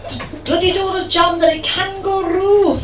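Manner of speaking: high, put-on Scottish cry of distress; distressed Scottish accent. Trapped man in toilet explains jammed door, distressed Scottish accent. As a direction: build to a desperate Scottish punchline. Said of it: "in distress in a Scottish accent".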